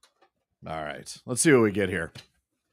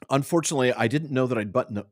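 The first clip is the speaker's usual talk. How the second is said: usual speaking voice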